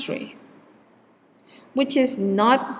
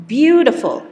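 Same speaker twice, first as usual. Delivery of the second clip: "beautiful" louder with BEAU-tiful stressed; 5 semitones higher, 7 dB louder than usual. Shown as a demonstration. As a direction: hit the first syllable BEAU of "beautiful". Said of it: "is also on the first syllable".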